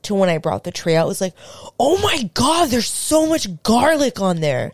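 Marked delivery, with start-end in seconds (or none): 1.8-4.7 high-pitched mock dismay; hammed-up, whiny outrage; appalled reaction. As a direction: imitate an over-the-top complaint about the food. Mimics partner's appalled reaction.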